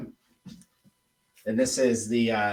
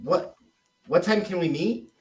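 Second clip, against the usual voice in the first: what time can we meet rising high at the end; upswing question; 6 semitones higher than usual. Shown as a demonstration. Coach showing how a rising meeting question sounds. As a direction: lift "time" and let "meet" climb. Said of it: "So if I said".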